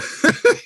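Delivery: unvoiced airy laugh; breathy laugh. Real, not acted. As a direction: laugh out under your breath. Laughs at Siri interrupting.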